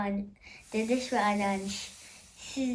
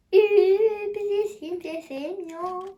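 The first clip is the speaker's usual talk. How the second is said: mock sulk before admitting it's a joke; mock sadness; 7 semitones higher, 4 dB louder than usual. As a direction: pretend to sulk that nobody loves us. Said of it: "Şaka".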